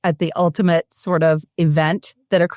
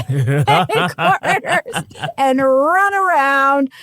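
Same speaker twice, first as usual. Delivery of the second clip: laughing; 5 semitones higher, much slower than usual. Real, not acted. Tells gross stunt amid laughter.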